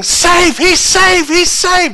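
high, loud insistent calls; acted team yelling. One team disputing a play at home, yelling. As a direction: yell it like a ballplayer arguing the call. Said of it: "One team is yelling".

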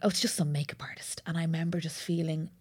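real whisper of humiliation. Real, not acted. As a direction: whisper it like you want to vanish. Recalling humiliation behind her.

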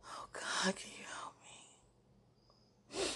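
breathless whispered plea; whispered prayer. Real, not acted. Emotional plea to God.

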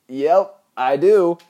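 high, loud, keen reply; retold eager yes; eager agreement. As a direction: answer high and loud, all too ready. Acts out own eager answer.

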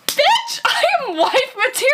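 high, loud outraged retort breaking into a laugh; indignant shout. Retold or imitated. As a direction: fire it back at the ex, laughing. Retold comeback to ex.

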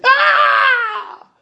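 long, very high, loud scream; scream of pain. A narrator's reading. Husband hit on his feet, screaming in pain. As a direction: scream out as the board lands.

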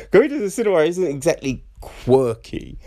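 ordinary talk

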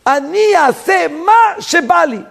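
high, stretched-out shout; brazen defiance. Retold or imitated. Egypt's brazen creed of doing whatever it wants, defiant high shout. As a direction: declare it slowly at a high shouted pitch.

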